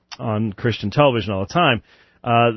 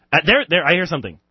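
ordinary delivery

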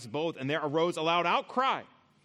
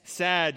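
sad, punched louder; pun on sad; 7 dB louder than usual. Shown as a demonstration. Preacher to congregation, punching the pun on sad. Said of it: "which made them".

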